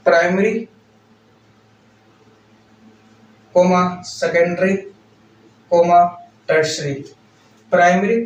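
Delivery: very slow, word by word for note-taking; measured classroom dictation pace. Real, not acted. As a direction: dictate it slowly with long pauses. Dictating a point for notes.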